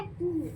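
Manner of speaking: कू with a long, rounded "oo". Shown as a demonstration. Teacher showing which sound is wanted, long rounded "oo". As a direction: hold कू softly on rounded lips. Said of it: "और sound कैसी है".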